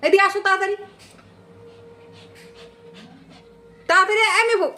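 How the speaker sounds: high, fast call to another room; yelling across the room. Real, not acted. Calls someone to remove mouse.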